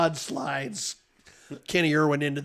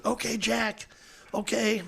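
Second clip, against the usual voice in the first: put-on higher, coaxing gravelly old official; gravelly steward voice; 7 semitones higher than usual. Steward Bill Carey calming Jack at the track, gravelly coaxing. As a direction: soothe a hothead like a smoky gym teacher. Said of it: "He's got a gravelly voice like that".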